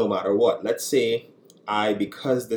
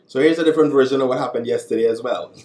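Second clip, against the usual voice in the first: eager loud start; 4 semitones higher, 8 dB louder, faster than usual. Real, not acted. Partner jumps in loudly.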